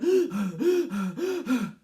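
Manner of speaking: out-of-breath panting gasp; exhausted wolf gasp. Acted. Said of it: "lie down on the pavement outside going".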